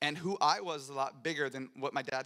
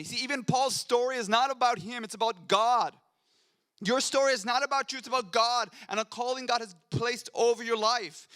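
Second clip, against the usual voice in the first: high, loud, driving preacher's cadence; preaching at full force; 6 semitones higher, 5 dB louder than usual. Acted. Pastor urging his congregation to endure like Paul, preaching at full force. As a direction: build the congregation up, every line a hammer blow.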